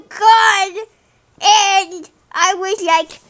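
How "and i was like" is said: usual speaking voice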